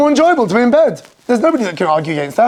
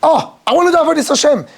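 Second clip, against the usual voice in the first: higher, acted fervor; hammed-up eager yearning; emotional enthusiasm; 7 semitones higher than usual. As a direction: work yourself up with longing. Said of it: "you've gotta get emotional about doing".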